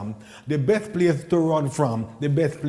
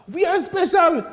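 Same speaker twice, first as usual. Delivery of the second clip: triumphant shout; 11 semitones higher than usual. Acted. Triumphant climax of comedy set.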